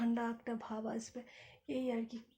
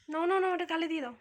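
quick, loud, higher coaxing; 4 semitones higher, 6 dB louder, faster than usual. Real, not acted. Mother coaxing her baby to kiss her cheek, quick and loud.

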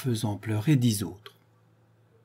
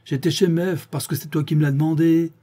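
raised, higher-pitched cry; cried out; 7 semitones higher, 6 dB louder than usual. A narrator's reading. Séraphin crying out to the gendarmes. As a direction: call out the protest with force. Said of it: "cria Séraphin".

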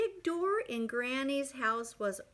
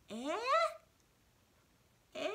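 deaf granny; 5 semitones higher than usual. Acted. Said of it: "And Granny says".

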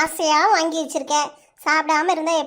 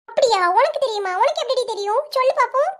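high-pitched, rapid-fire doubting questions; sceptical challenge; 7 semitones higher than usual. Acted. Child challenging a claim to know the recipe, sceptical. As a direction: pipe up in disbelief, daring an answer.

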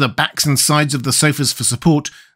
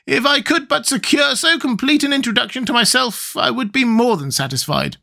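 very high squeaky creature; high-pitched squeaky character; Gump's squeaky voice; 13 semitones higher than usual. A narrator's reading. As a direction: squeak it out, far above your own voice. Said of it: "said the Gump".

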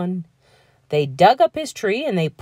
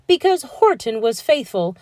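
ordinary delivery, nothing marked

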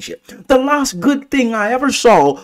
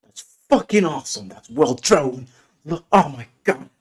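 ordinary talk, nothing marked

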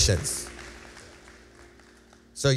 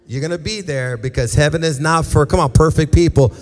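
full-force preaching; 2 semitones higher, 6 dB louder than usual. Acted. Preaching to the room.

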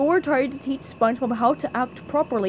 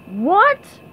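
loud, higher questioning yell; driver yelling; 7 semitones higher, 7 dB louder than usual. Acted. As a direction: yell it as if you can't hear. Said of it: "yelled the driver".

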